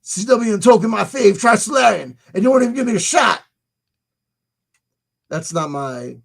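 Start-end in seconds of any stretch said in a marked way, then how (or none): 0.0-3.4 lightly voiced hyped-up Wilcock impression; a hint of a street-talking Wilcock, higher; David Wilcock voice. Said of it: "That's my David Wilcock voice".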